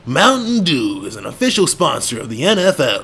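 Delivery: a light sports-ad announcer. Pretend. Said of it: "that one I used to do".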